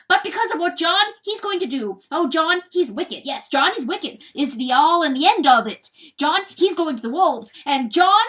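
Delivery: high, loud weasel tirade; roaring to hold back tears; shouting at full lung. A narrator's reading. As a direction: shout it out, filling yourself with roaring. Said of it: "he shouted at the top of his lungs".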